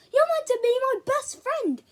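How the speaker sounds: high, loud hurt cry; angry shout. Acted. Boy shakes his friend by the collar, angry. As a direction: shout it while shaking him.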